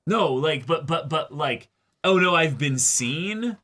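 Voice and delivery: ordinary talk, nothing marked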